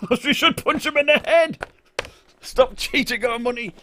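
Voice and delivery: high put-on voice, hammed-up menace; mock tough threat. Hamming up a threat to his friend Heng Sheng in a high put-on voice. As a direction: play a cartoon bully threatening Heng Sheng.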